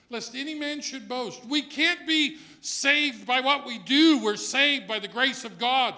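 loud, rising preacher's cry; full-force preaching. Acted. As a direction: preach it out to the back pew. Preacher builds to full-force climax.

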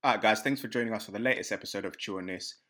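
low, soft host sign-off; podcast outro. Acted. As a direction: wrap up the show calmly. Host signs off the episode.

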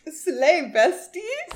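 high, loud playful cheer; hyped-up slay. Acted. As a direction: gush it in a high, drawn-out voice. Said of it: "and I was like".

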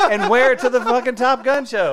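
loud, high, laughing through words. Real, not acted. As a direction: talk while cracking up. Laughing while telling plan.

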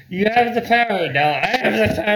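high, loud taunting voice; mock menacing friend. Friend grabbing the knife, taunting in a high loud voice. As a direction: lord it over him, high and loud. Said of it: "He's like".

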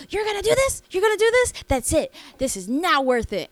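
mock her screeching at Bud; shrill drunk wife. Bartender imitating the drunk wife yelling at her husband. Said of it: "She was like".

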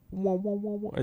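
high wobbling "wah wah" sound; imitated wobble noise. Showing listeners how the tapped mic jiggles, imitated wobble. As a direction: mimic a springy jiggle with high wahs.

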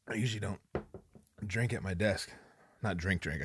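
ordinary talk, nothing marked

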